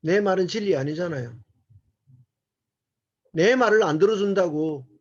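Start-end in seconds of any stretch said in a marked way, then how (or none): none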